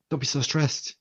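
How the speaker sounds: high, light and offhand; breezy reassurance. Retold or imitated. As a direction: wave his nerves away. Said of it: "trying to calm him down by going".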